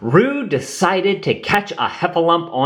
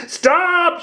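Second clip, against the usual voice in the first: high shouted stop; a little joey's high shout. A narrator's reading. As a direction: yell it out high and sharp. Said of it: "shouted Roo".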